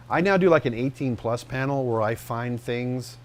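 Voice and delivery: ordinary delivery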